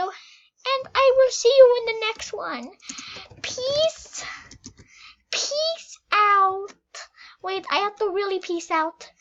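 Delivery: high, squeaky playful goodbye; high silly farewell; goofy high sign-off. Real, not acted.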